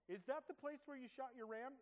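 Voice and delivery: high, eager teasing voice; mock eager teasing. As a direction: egg him on like a curious kid. Teasing the friend again.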